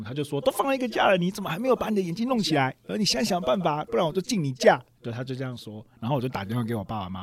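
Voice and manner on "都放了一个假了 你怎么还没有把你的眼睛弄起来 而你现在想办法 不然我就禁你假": higher, scolding officer's rebuke; angry officer. Retold or imitated. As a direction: scold him like a furious superior. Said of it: "长官就很愤怒". (长官愤怒地训斥士兵，语调高而凶)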